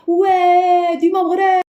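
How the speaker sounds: shouted high chant for Morocco; cheering shout. Acted. Kid cheering Morocco's team with a loud shout.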